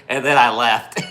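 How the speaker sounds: loud punchline through real laughter; laughing. Real, not acted. Storyteller closing his binky tale, laughing aloud. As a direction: finish the story cracking up.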